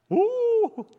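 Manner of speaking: high loud whoop of amazement; excited whoop. Real, not acted. Preacher to congregation, a high loud whoop. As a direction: whoop at eleven hours of sleep. Whoop at Einstein's sleep hours.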